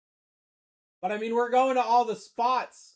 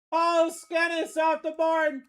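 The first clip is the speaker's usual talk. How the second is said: hype call; 6 semitones higher, slower than usual. Real, not acted. Announcing pull in hyped voice.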